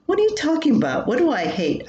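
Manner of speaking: sudden high, fast puzzled question; puzzled surprise. Retold or imitated. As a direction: jump in, baffled by his remark. Puzzled wife questions husband.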